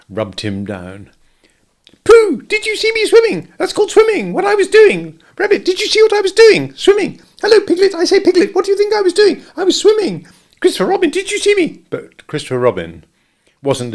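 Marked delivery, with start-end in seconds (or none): 2.0-11.8 excited squeak. A narrator's reading. Roo boasting after climbing out, excited squeak. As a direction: squeak with pride.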